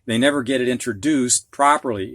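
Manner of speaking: ordinary delivery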